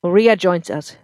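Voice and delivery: put-on loud, bitter drunk husband; drunk angry husband. A narrator's reading.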